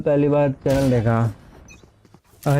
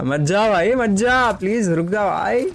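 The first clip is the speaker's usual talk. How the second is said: mock pleading; 6 semitones higher than usual. Viewer leaving, streamer begs.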